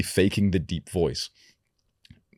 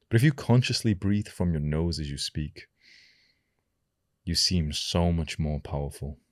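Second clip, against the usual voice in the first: ordinary delivery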